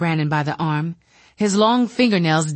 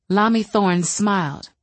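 usual speaking voice

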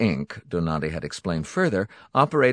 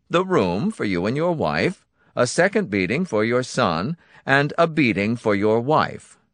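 raised, beaming cruelty; gleeful Donati voice; 5 semitones higher, 4 dB louder than usual. Acted. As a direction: list punishments with glee.

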